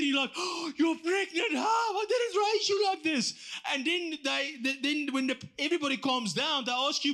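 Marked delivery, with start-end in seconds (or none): 0.4-3.3 high, slow, shouting parent in disbelief; shocked parent. Acted. Parent shouting at pregnant daughter, high and slow. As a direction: belt it out like an appalled mother.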